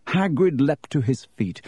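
usual speaking voice

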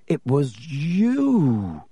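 very slow, drawn-out realisation; slowly dawning suspicion, long drawn vowels. A narrator's reading. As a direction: stretch the words right out. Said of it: "he said slowly".

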